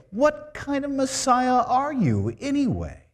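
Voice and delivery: high, loud pretend indignation; mock blaming Mary. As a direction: accuse the Messiah, hammed up. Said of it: "She didn't say".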